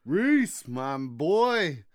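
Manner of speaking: high, loud shouted name; hype shout. Acted. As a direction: yell it out like a hype man. Reading patron names, hyped.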